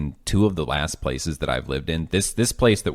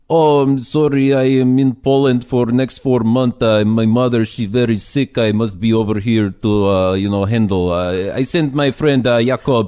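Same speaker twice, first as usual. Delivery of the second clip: loud, higher, hammed-up Polish accent; worst Polish accent; 5 semitones higher, 6 dB louder than usual. Builder on the phone making excuses, hammed-up Polish accent. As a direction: do a bad, booming Polish accent. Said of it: "do my worst Polish accent ever".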